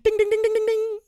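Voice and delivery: high sung ding; chiming game-show ding; mock bell. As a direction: ring it like a quiz bell.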